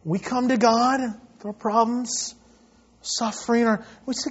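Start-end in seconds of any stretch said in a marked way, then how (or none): none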